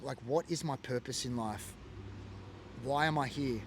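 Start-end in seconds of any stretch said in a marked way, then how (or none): none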